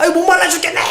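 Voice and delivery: high-pitched, exasperated wail of thirst; mock complaint. Thirsty Israelites moan in the wilderness, mock complaining.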